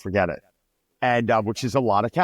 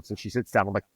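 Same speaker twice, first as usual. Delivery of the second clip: normal talking voice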